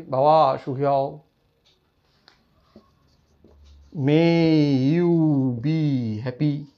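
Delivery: slow drawn-out blessing. Retold or imitated. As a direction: bless them tenderly and slowly. Blessing a bowing child.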